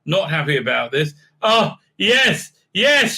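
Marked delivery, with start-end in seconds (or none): 1.4-3.1 high, punchy cries of pleasure; hammed-up ecstatic exclamations; mock aroused cries. Vulcan woman aroused by the mind meld, in mock high cries. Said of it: "quite aroused".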